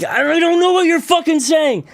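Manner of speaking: high, strained protest; exasperated. Retold or imitated. Baffled man to singing woman, high exasperation. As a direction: blurt it out at your wits' end. His exasperated retort, getting anxious.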